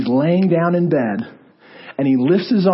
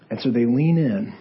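ordinary delivery

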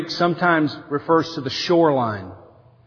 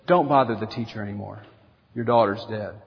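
much lower and softer, breaking the news gently; hushed bad news; 8 semitones lower, 7 dB quieter than usual. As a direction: murmur it low, like news no one wants to deliver. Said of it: "whispers to Jesus".

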